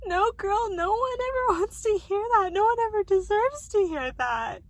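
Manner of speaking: crying hard and loud over being hurt; loud tearful outburst. Real, not acted. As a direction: cry out through the tears. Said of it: "I'm all emotional about it".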